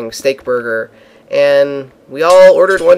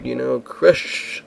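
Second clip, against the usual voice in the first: bad Australian accent; 6 dB quieter, much slower than usual. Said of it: "That's my bad Australian accent".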